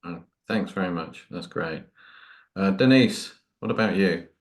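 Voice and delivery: ordinary delivery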